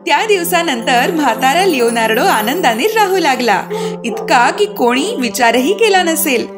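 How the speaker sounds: ordinary delivery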